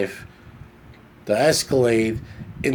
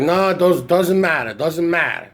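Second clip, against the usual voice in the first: hammed-up uncle protesting, pitched up; imitating uncle; 4 semitones higher than usual. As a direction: play the stubborn uncle, insisting over and over. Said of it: "He's like".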